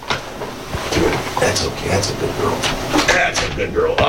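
whispered coaxing to a cat; calm the cat under your breath; hushed praise for a pet; soothing whisper. Real, not acted.

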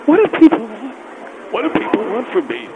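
high-pitched, worked-up pleading; exasperated plea. Acted. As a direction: plead in mock desperation, pitch pushed up. Acted exasperated plea to Phil.

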